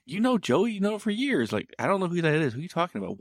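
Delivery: high-pitched, baffled back-and-forth; acted incredulity. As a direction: mimic friends disputing in disbelief. Acted argument between baffled friends.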